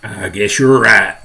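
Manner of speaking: slow, loud character drawl; fire chief's voice. Acted. Fire chief conceding to police chief, slow character voice.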